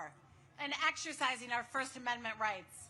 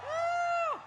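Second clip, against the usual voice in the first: excited whoop; 14 semitones higher than usual. Real, not acted. Greeting a cheering rally crowd.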